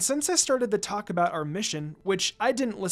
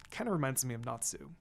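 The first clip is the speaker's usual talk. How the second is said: ordinary delivery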